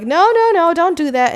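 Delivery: high-pitched mock alarm; alarmed protest. Friends begging Louisa not to jump, high alarmed protest. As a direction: plead with her to stop, voice jumping up. Acted pleading against a jump.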